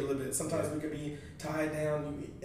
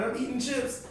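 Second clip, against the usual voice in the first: ordinary talk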